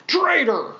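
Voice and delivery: high accusing stormtrooper cry; stormtrooper yelling traitor. Pretend. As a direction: hurl it as an angry trooper. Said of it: "That guy's probably saying".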